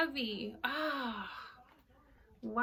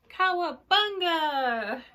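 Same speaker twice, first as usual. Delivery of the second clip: cowabunga cheer; 4 semitones higher, 5 dB louder, slower than usual. Acted. Fish shown in new tank.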